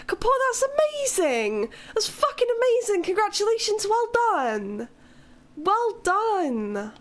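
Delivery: high and thrilled; excited congratulations. Real, not acted. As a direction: cheer a friend's good news. Congratulating viewer on job.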